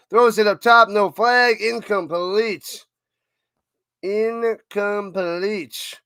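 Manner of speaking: up-top call fading into a slow, drawn-out "incomplete"; play-by-play call. Acted. Calling an incomplete pass for chat, drawn out. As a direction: let the last word sag slowly.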